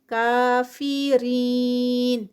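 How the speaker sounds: کافرین very slow, کا two counts, رین four counts; four-count stop madd. Shown as a demonstration. Teacher showing madd on stopping. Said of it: "stop پر four counts اس کا stretch ہوگا".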